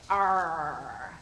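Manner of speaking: low growly pirate arr; low pirate growl, arr. Shown as a demonstration. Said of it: "so it's like a pirate".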